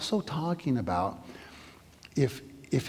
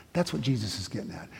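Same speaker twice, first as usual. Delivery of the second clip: ordinary talk, nothing marked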